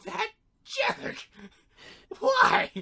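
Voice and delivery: a little imp wailing in outrage; squeaky imp wail; high whiny wail. Retold or imitated. Mixie wails as Lobo leaves.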